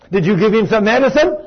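high, loud acted question; incredulous questioning. Mother questions Betty about her son's changed behavior, incredulous. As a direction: demand an answer in amazement.

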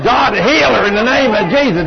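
preacher's ringing plea for healing; commanding healing prayer. Retold or imitated.

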